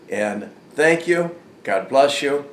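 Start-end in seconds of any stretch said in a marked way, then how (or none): none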